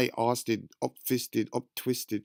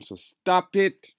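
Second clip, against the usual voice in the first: slow, higher and louder; slowed, raised command; stern warning; 7 semitones higher, 5 dB louder, much slower than usual. Real, not acted. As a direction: drag out each word as a warning.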